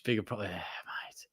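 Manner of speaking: a quick half-swallowed whisper; whispered aside. Real, not acted. Streamer answering chat in a passing whisper. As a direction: breathe it out as a whisper.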